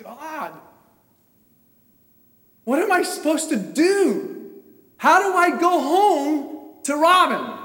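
high, loud plea to God; retold desperate cry. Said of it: "I am screaming, whistling, yelling, crying".

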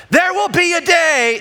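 high, loud dramatic proclamation; yelling. Retold or imitated. Dad yelling a lesson at his kids, loud and high. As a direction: shout it like a prophecy. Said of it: "I'm yelling at my kids saying".

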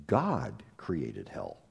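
"God created hell" said low, slow and heavy; grave low emphasis. Real, not acted. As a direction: drop it low and slow for weight. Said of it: "Let that settle down on you".

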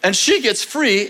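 ordinary delivery

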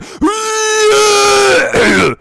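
loud, high throat-clearing roar; exaggerated hacking yell; clearing vocal cords. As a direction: force a huge cough to free the voice. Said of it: "break through these, uh, these vocal cords".

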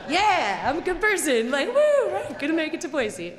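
high, loud and pleased with herself; smug cheer. Retold or imitated. To the roadside strangers, a loud proud cheer. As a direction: cheer herself on for being good. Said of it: "I'm like".

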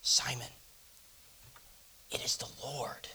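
breathless whisper, almost no voice; awed whisper. Acted. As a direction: whisper it like a secret too big to say. Tells Simon, gripping his arm.

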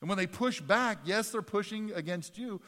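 rally the parents to stand firm; preaching at full force. Acted. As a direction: passionate preaching. Exhorting parents.

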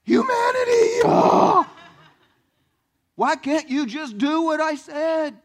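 high crying shout of frustration; hammed-up divine howl, high and loud; anguished cry as God. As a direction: wail at humanity like an exasperated God. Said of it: "Just literally like".

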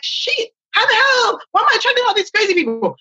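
high, frantic disbelief; exasperated outburst. Retold or imitated. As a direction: squeal it in panic. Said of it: "you're gonna be like".

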